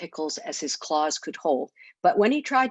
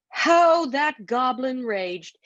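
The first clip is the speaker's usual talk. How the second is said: dramatic narration; 5 semitones higher, 3 dB louder, much slower than usual. A narrator's reading.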